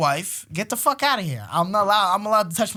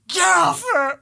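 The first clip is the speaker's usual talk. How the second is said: put-on higher, louder kid shout; kid yelling; 12 semitones higher, 5 dB louder, slower than usual. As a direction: yell like a jealous little son. Son yelling at dad.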